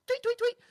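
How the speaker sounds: high squeaky tweets; mock bird tweet. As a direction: chirp like a flute. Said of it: "he goes".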